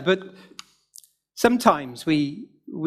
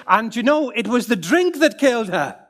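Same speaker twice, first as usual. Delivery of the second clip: higher, louder Northern Irish lilt, deadpan; hint of Northern Irish accent, higher and louder; Northern Irish chap; 8 semitones higher, 5 dB louder than usual. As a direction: deliver the punchline like the mischievous Irishman. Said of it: "he says to me".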